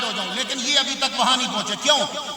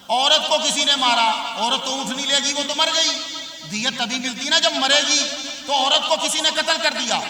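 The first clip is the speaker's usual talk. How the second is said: building from plain talk to loud, high preaching; fiery Urdu sermon argument; rising sermon; 4 semitones higher, 3 dB louder than usual. Acted. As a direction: climb steadily to a booming climax.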